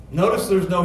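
ordinary delivery

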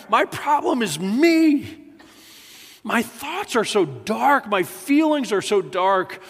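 high, pleading cry of anguish; anguished plea. Acted. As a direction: voice David begging God in terror. David's anguished cry voiced.

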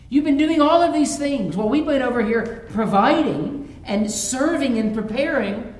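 loud, rising parental indignation, slowing on how dare you; indignant outrage. Acted. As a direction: scold the ungrateful kids at full volume. Mock outrage at ungrateful kids.

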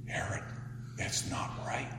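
hushed rebuke. Retold or imitated. John rebuking Herod quietly.

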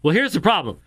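usual speaking voice

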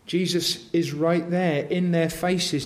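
usual speaking voice

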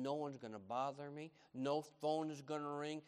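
ordinary talk

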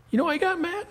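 ordinary delivery

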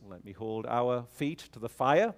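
normal talking voice

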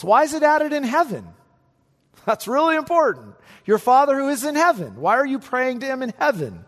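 rally the congregation against worry; fired-up preaching. Acted. Preacher presses point on heaven.

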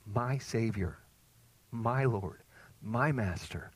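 usual speaking voice

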